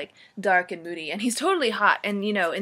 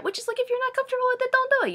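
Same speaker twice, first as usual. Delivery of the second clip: much higher and rushed, exasperated; high fast rant; much faster than usual. Real, not acted. Vlogger rants that nobody should be forced into it, high and fast. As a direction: fire it off in a squeaky rush. Ranting aside about Jose.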